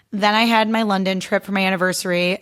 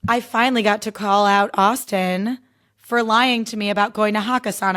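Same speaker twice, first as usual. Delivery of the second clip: ordinary talk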